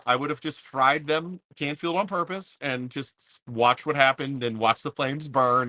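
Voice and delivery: ordinary talk, nothing marked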